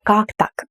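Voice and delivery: Как said strong, так trails off; stress on Как. Shown as a demonstration. Teacher demonstrating stress on Как. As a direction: hit Как first. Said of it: "The first word is more emphasized".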